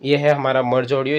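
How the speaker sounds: ordinary talk, nothing marked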